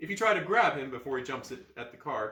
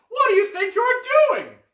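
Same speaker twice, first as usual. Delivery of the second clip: furious shriek; 15 dB louder than usual. Acted. Girl shouting at vandal.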